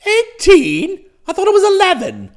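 loud, high yelp of alarm; shocked. Acted. Master learns his file has grown, shocked. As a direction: cry out aghast, fast and high.

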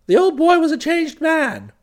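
ordinary delivery

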